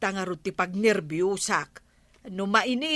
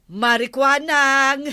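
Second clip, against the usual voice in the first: louder call across the yard, higher pitch; 5 semitones higher, 6 dB louder than usual. Acted. Calling out to Marikwanang, loud and high. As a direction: call her name from a distance.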